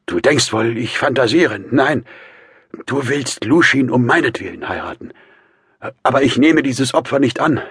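high, loud, insistent man; agitated Raskolnikow. A narrator's reading. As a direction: lay down your demand forcefully. Brother gives orders.

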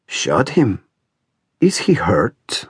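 put-on slow, half-whispered old man's question. A narrator's reading. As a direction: ask slowly in an aged, airy voice. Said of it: "said the old man".

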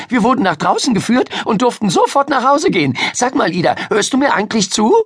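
put-on high, fast girl's prattle; excited storyteller girl. Miriam chattering at a distracted Ida, excited. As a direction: rattle it off high, then check she's listening.

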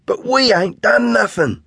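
high protesting whine; boy protesting to the constable, high and hurt; objecting. A narrator's reading. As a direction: protest your innocence. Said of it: "Tan objects".

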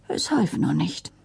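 ordinary delivery, nothing marked

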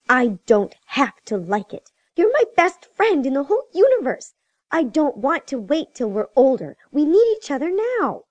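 put-on high-pitched, irritated little girl; irritated child. A narrator's reading. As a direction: protest with rising irritation, pitch leaping up. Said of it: "her irritation with the situation evident in her tone".

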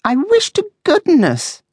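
very high, drawn-out grumble; high-pitched, long-suffering moan; complaining. A narrator's reading. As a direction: complain in a squeaky-high character voice. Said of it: "she complained".

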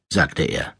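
ordinary delivery, nothing marked

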